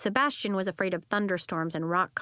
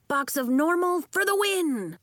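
much higher, louder cheer; 9 semitones higher, 5 dB louder than usual. Acted. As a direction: cheer her on. Calls cheer after her.

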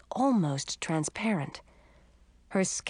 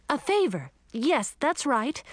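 put-on higher, hesitant embarrassed girl; nervous girl voice; 7 semitones higher than usual. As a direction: answer high and flustered. Narrator girl answers, embarrassed.

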